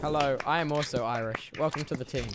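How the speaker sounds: Irish accent. Shown as a demonstration. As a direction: join in with a low Irish accent. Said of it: "you do an Irish accent".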